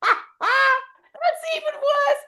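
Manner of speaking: high, loud, slow shocked exclaim; shocked delight. Real, not acted. Reacting to the story twist, high and loud. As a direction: gasp it out, scandalised.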